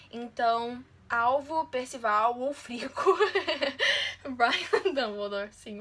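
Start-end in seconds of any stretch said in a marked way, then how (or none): 1.1-5.5 laughing recital. Real, not acted.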